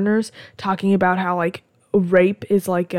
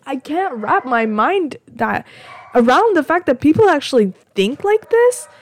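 higher, incredulous exasperation; baffled; 6 semitones higher than usual. Real, not acted. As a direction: stretch it out in real disbelief. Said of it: "which is baffling".

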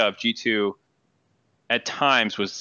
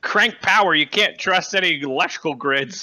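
paranoid prepper voice; 6 semitones higher than usual. Pretend. Joining the doomsday caller bit.

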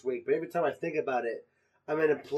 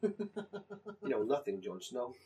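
Game of Thrones quote; 4 semitones lower, 11 dB quieter, much slower than usual. Retold or imitated.

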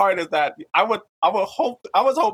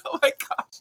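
breathy, voiceless oh my gosh; whispered dismay; 4 dB quieter than usual. Retold or imitated. Recalling the woman telling every room, whispered dismay. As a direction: whisper it in shock. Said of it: "I was like".

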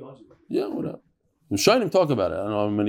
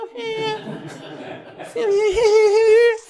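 high giggle. Real, not acted. Mocking the fake sweet voice.

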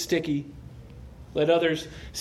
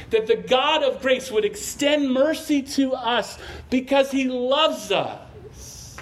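louder, higher preaching climax, slowing on key words; preaching at full force; 6 semitones higher, 4 dB louder than usual. Acted. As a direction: drive the point home to the congregation.

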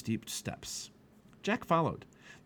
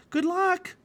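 high, loud call of good luck; girl calling a cheerful farewell to a scholar; cheerful cry; 6 dB louder than usual. Acted. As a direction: call it out brightly as she runs. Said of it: "cried Annie".

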